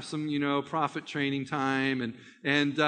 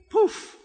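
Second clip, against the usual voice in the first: poof sound effect; 14 semitones higher, 4 dB louder than usual. Retold or imitated. Magic effect after the command.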